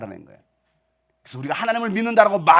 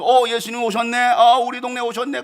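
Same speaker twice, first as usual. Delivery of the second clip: higher, louder excited cry; excited villagers; 6 semitones higher, 6 dB louder than usual. Acted. Villagers spotting Jesus in their town, excited. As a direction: call out the news with excitement.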